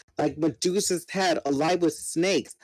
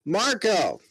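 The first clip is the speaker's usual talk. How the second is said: yelling; 6 semitones higher than usual. Acted. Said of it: "I yelled".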